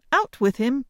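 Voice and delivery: loud high princess command; cartoon princess. A narrator's reading. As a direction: bark it as a royal order. Princess dismisses suitor.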